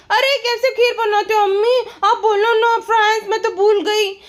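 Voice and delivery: high, sing-song mock of a fake-innocent vlogger; mocking a vlogger who pretends she can't cook; mock childish vlogger. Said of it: "यहाँ पर जो बोल रही है".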